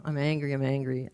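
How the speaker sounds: low-energy, unconvinced attempt at anger; half-hearted anger. Group member obeying the leader's order to try, half-hearted. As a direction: try to sound angry and fail, mildly.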